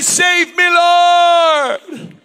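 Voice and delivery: loud, high plea; crying out. Acted. Preacher voicing a sinking man, loud desperate cry. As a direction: call out for rescue.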